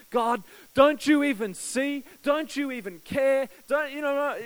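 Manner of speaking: high, slow, pleading cry to God; anguished plea. Retold or imitated. Intern wrestling with God while cleaning the church, loud desperate plea. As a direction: beg God to see him, loud and drawn out. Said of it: "wrestling with God".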